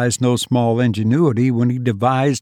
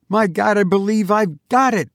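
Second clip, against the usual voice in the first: higher, rising to a triumphant cry; excited cry; 8 semitones higher than usual. A narrator's reading. Narrator seizing his friend's arm, crying out in triumph. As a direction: shout out the sudden discovery. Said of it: "I cried".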